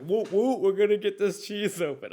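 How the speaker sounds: imitating a rowdy fan, shouted high; juggalo impression. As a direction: whoop it up like a hyped juggalo with a hatchet. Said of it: "They're like".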